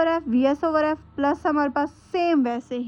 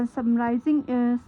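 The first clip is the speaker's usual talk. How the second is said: ordinary talk, nothing marked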